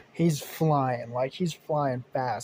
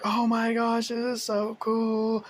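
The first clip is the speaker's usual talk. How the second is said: high, slow, delighted shout; thrilled scream; 8 semitones higher, slower than usual. Retold or imitated. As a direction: yell with joy on the wild ride. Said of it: "as he's, like, screaming".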